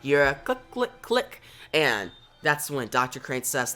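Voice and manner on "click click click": very high little clicks; tiny high-pitched click sound effect; imitated clicking. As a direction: mimic a switch in a high voice.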